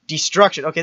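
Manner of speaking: usual speaking voice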